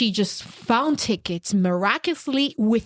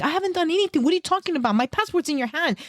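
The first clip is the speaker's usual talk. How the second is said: exasperated; 7 semitones higher than usual. Retold or imitated. Insisting to the guard.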